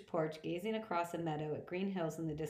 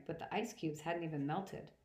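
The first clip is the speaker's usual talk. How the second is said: plain reading voice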